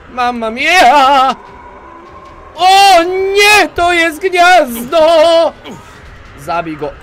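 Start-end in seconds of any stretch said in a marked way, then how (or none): 0.1-1.3 mock Italian. Game panic, mock Italian exclamation. 2.5-5.5 much higher, louder and slow, mock terror; hammed-up panic. Gamer finding a monster nest, in hammed-up high panic.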